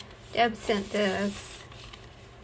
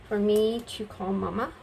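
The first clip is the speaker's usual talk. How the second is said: usual speaking voice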